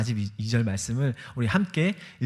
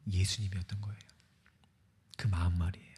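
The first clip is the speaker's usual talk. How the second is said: hushed, lower whisper; 7 semitones lower, 7 dB quieter, faster than usual. Real, not acted. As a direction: breathe it out as a secret. Hushed sermon point. (속삭이듯 낮은 목소리)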